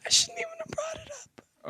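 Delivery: choking up while speaking; crying. Real, not acted. To the interviewer about her husband, breaking into tears. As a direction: voice breaking high and soft into tears. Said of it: "I didn't want it to make you cry".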